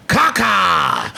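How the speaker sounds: put-on loud, proud little-kid shout; mock child yelling his joke; kid's shouted punchline. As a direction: blurt it out like a four-year-old. Son's one-word joke retold.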